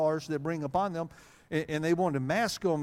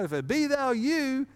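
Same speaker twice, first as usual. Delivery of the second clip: higher, a hint of eager Peter; mock eager Peter; 10 semitones higher, 4 dB louder than usual. Preacher acts out Peter calling to Jesus, lightly voiced. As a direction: lean in, lightly playing bold Peter. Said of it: "bid me to what?".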